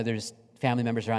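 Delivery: usual speaking voice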